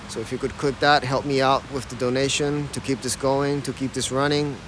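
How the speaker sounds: normal talking voice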